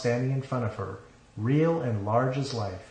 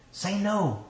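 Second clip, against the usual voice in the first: urging; 9 semitones higher than usual. A narrator's reading. Inner self urging the bride to refuse, high and urgent. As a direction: urge it in a high, tight-held voice. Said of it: "she urged".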